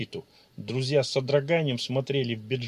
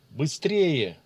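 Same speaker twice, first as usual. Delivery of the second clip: growled urgent shout; 3 dB louder than usual. A narrator's reading. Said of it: "прорычал Ичиро".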